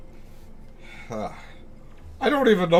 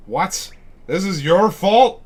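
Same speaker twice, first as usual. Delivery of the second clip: high, loud disbelief; surprised; 7 semitones higher, 9 dB louder, slower than usual. Real, not acted. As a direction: react in shock, drawn out. Shocked at game dialogue.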